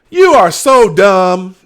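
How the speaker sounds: slow, loud, drawn-out "you are so dumb"; internet meme voice. Retold or imitated. Quoting the meme guy at Joe, slow and loud. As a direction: stretch each word out like the meme guy mocking someone. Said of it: "the guy that goes".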